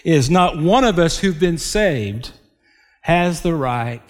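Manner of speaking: ordinary talk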